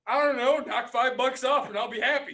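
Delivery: man retells haggling with the billing clerk, loud and breezy; loud offhand offer. Retold or imitated. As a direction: toss it off loudly, half daring her. Caller retells his own offer.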